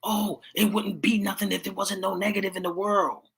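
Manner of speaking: high, mocking singsong of their excuse; mocking what people say. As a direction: parody the people who shrug it off. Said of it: "So when people say".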